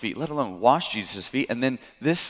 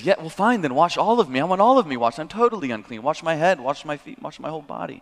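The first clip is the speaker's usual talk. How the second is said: higher and louder, gushing and insistent; overeager demand; 6 semitones higher, 5 dB louder than usual. Retold or imitated. Peter begging Jesus to wash all of him, excited and higher. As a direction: swing to the opposite extreme, all in.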